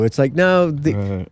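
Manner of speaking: normal talking voice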